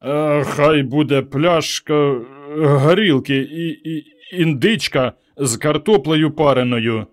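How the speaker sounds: put-on slow, slurred drunken orders with a laugh; drunk slurring. Drunk Petro orders a feast, slurring slowly. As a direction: slur it like a man drunk as a lord. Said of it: "п'яний як чіп, заплітаючись язиком".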